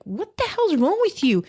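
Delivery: high-pitched, retold outraged demand; indignant retort. Wife confronts her storming husband, retold indignant retort.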